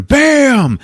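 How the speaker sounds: high explosive "bam"; dramatic storyteller burst; sudden impact effect. Retold or imitated. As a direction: punch it out as a crash. Imitates monster bursting out.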